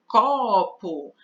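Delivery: "Copo" with the first syllable "co" stressed. Shown as a demonstration. Teacher to class, showing that "Copo" is stressed on "co". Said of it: "A palavra forte é a penúltima".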